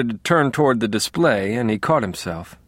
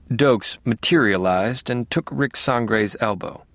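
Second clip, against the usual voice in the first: ordinary delivery, nothing marked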